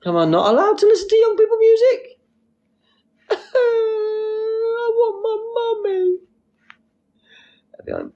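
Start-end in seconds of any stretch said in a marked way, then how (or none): 0.1-2.2 very high-pitched mock protest; mock indignation. Streamer defending their music taste, mock indignant. 3.3-6.2 very high, drawn-out fake wail; mock crying child. Streamer playing wounded after music question, mock crying.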